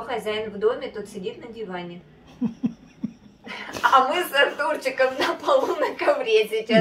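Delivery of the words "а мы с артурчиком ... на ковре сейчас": loud laughing telling. Real, not acted. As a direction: laugh through the words. Laughing while telling what happened.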